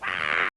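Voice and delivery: loud mock "aah" scream; imitated scream back. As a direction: act out her answering yell. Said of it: "Go ahead, scream back".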